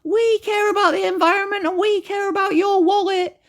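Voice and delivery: very high, simpering put-on voice; mocking high-pitched do-gooder voice; mock advertiser. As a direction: mimic the company in a squeaky sing-out. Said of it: "They're trying to say".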